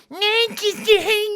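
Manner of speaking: very high, loud whiny noise; piercing nonsense mimicry on one held pitch; mocking her voice. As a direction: squeal a garbled caricature of her voice. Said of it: "her voice comes to me off like".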